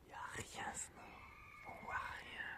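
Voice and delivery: very soft whisper to an animal; hushed whisper. Real, not acted. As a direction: whisper so as not to disturb it. Talks softly to an animal eating. (chuchoter tout doucement)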